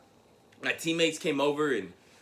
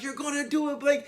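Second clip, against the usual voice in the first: high excited mock yell of a dad; proud father hollering encouragement; 12 semitones higher than usual. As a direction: cheer him round the bases, voice way up. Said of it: "like, yelling and screaming".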